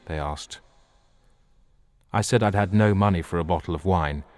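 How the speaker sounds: ordinary delivery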